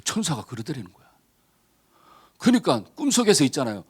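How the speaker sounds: ordinary talk, nothing marked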